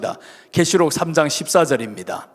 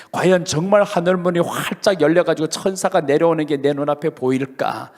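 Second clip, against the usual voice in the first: usual speaking voice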